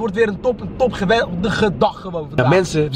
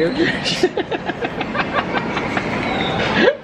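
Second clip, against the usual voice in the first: long high airy laugh. Real, not acted. Vlogger teasing friend about speed, long high laugh. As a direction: laugh at your own teasing.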